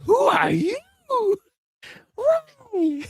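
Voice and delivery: very high, shouted through laughter; mock old lady laughing. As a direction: squeal the question, cracking up. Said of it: "He's like".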